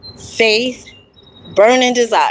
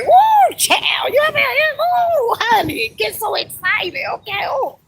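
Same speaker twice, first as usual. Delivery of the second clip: squealing high woo, then an excited shout; bursting with real joy at the view; real excited whoops. Real, not acted. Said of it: "honey, I'm getting so excited".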